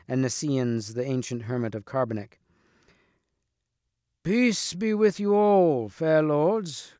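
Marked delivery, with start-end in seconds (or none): 4.2-6.9 high, slow, drawn-out proclamation; ringing, raised-voice salutation; crying out. A narrator's reading. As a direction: call a greeting out to all the lords. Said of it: "cried Nisiens".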